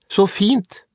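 put-on high-pitched girl's shout; acted childish retort; calling after him. A narrator's reading. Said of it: "ropte Åse etter ham".

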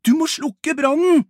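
loud, high-pitched frantic yell; urgent shout. Acted. Albert orders Milo to put out the fire, shouting. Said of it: "roper Albert".

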